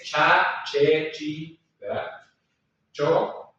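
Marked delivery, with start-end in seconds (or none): 0.0-1.6 cha, che, chi with Spanish CH. Shown as a demonstration. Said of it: "A diferencia del español".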